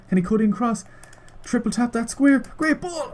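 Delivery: rising live-commentary excitement. Real, not acted. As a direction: pitch shoots up as the chance builds. Chance building in the game.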